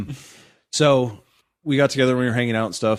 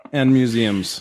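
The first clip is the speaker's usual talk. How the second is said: ordinary talk, nothing marked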